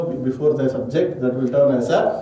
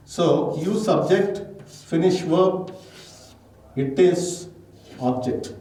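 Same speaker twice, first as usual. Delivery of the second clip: slow dictation; 2 semitones higher, slower than usual. Shown as a demonstration. Teacher dictating sentence parts.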